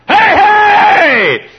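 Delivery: excited announcer's high, full-voice shout; Brickhouse. Acted. Announcer cheering a home run, excited shout. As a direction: holler it out long and high. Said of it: "I even like old Brickhouse".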